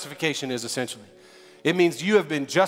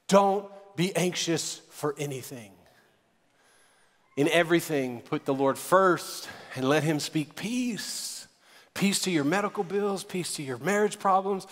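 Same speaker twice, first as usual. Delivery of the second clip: slow, hushed pastoral reassurance; soothing peace; 5 dB quieter than usual. Real, not acted. Preacher speaking peace over his congregation's troubles, slow and soothing. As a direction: speak peace softly, with long pauses.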